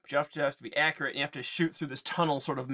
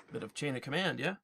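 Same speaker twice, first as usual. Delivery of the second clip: ordinary delivery, nothing marked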